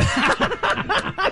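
high, soft squeal of laughter. Real, not acted. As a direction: dissolve into giggles. Laughing at the reading.

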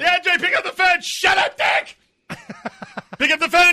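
loud, high desperate shout; yelling at the phone. Acted. Owner yelling at the voicemail to pick up. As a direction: lose it and yell into the voicemail. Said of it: "You are losing it".

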